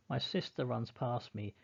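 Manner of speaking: ordinary delivery, nothing marked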